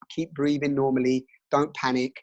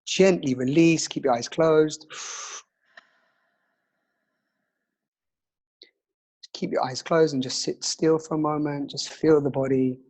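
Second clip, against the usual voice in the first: calm counting breath guide; slow soothing breathwork instructor; guided meditation; slower than usual. Acted.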